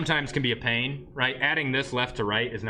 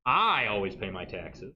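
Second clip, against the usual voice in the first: slow, drawn-out "I", the rest falling away; stress on I; 5 semitones lower, slower than usual. Shown as a demonstration. Teacher showing emphasis changes meaning, stress on "I". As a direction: lean long on the word "I".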